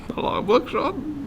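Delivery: half-volume incredulous whine; mock exasperation. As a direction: squeak the name out in mock disbelief. Repeats the scolding as a gag.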